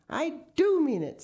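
high, loud protest; pleading at high pitch; desperate insistence. A narrator's reading. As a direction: insist frantically, leaning on the verb. Mister Gregg pleading at gunpoint.

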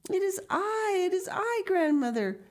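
put-on high, eager child's cry; joyful cry. A narrator's reading. Heidi runs to her grandmother, joyful cry. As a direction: call out high to be recognised. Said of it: "cried Heidi".

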